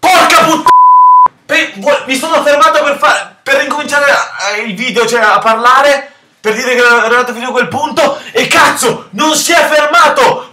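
loud, high furious rant; furious yelling. Real, not acted. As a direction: explode at the broken game. Rage after cat fails to stop. (sfuriata urlata)

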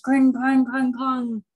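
high ringing vocal clangs; clanging swords. Acted. Reader voicing a battle, loud clanging noises. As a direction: imitate swords clashing loudly.

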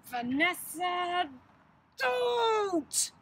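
drawn-out, high and loud growl; growled warning. A narrator's reading. Wolfish sister warns Vanessa off her painting, growling. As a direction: growl it as an order, stretching the name. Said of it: "she growled".

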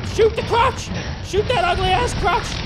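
high-pitched, heated battle shouts; frantic yelling. Real, not acted. Player fighting the monster, frantic angry yelling.

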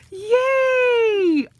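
very high, loud cry of joy; delighted shout. Real, not acted. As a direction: scream with glee at opening it.

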